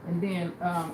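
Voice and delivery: ordinary talk, nothing marked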